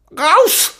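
laut und sehr hoch geschrien; wütend hinausbrüllen; Schrei. A narrator's reading. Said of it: "schrie Seki". (wütender Schrei)

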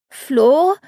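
a high, worried call of a name; a girl on the phone reaching out, desperate. A narrator's reading. Girl calling her friend's name on the phone, desperate and high. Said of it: "Ihre Stimme klang jetzt ganz nah und verzweifelt".

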